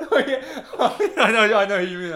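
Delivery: high, loud words through laughter; real helpless laughter. Real, not acted. Agreeing with friend about the weird guy, laughing hard. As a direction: talk while cracking up.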